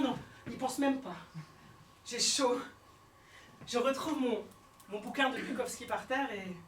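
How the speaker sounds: ordinary talk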